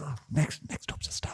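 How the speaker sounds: whispered, hushed mock announcer; whispered mock backstage caller. Imitating audition call.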